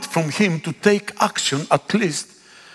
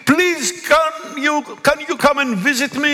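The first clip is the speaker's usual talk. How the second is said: high, pleading plea for help; pleading phone call; 6 semitones higher than usual. Pretend. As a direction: beg a friend to come over. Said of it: "pick up the phone and say".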